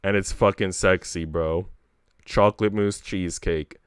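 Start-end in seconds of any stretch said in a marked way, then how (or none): none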